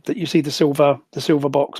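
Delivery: normal talking voice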